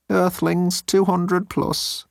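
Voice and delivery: high, slow alien report; squeaky crewman drawling a report. Acted. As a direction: drag it out high and thin. Constant reports.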